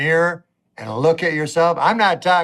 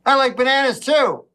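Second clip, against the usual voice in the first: higher, cheerfully echoing the friend; lightly voiced happy agreement; a hint of childlike cheer; simple happy voice; 7 semitones higher than usual. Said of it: "And I go".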